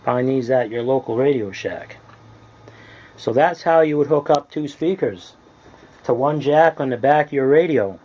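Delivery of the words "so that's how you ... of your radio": ordinary talk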